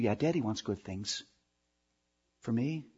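lightly voiced child answering daddy; child's reply. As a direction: mumble it back like a small child.

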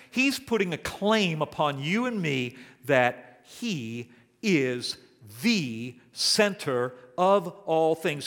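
ordinary talk

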